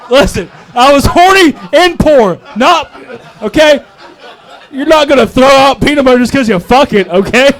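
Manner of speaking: high, loud comic indignation; indignant outburst. Acted. Comic defending himself to audience, indignant outburst. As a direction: protest at full pitch, punching the words.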